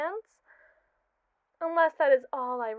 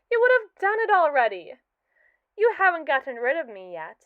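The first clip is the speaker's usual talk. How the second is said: higher and louder, a gotcha; triumphant taunt; 4 semitones higher, 6 dB louder than usual. Acted. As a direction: throw it back as proof. AI taunts creator about scrapping.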